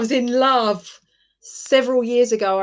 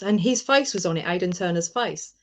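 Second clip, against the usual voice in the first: usual speaking voice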